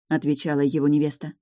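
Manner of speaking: usual speaking voice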